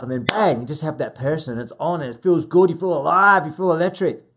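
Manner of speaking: loud, punchy burst of excitement; exhilarated. Real, not acted. Acting out thrill of a connected call.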